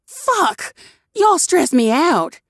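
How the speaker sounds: high, sharp curse then a vent; exasperated outburst. A narrator's reading. Usha swears at the crew in frustration, high and sharp. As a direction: blow off steam.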